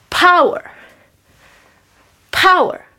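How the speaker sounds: loud, high barked power; drama teacher. Acted. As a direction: punch each power like a coach. Instructor barking workout word.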